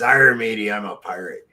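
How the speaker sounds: pirate. Acted. Pirate answers the tavern customer, hammed-up pirate voice. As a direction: play a salty joke pirate. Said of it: "Are you a pirate?".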